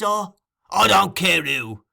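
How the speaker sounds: loud, drawn-out, high outburst; impatient. A narrator's reading. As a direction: cut off the list impatiently. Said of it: "replied Sikes impatiently".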